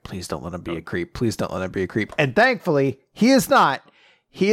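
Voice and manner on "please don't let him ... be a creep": low, softened pleading under the breath; hushed, low-pitched inner plea; muttered plea. Retold or imitated. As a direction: plead quietly to yourself. Retelling an anxious silent plea.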